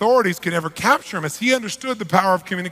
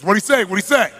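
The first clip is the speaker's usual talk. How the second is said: impatient demand; 3 semitones higher, 5 dB louder than usual. Retold or imitated. Boss impatiently asks interpreter.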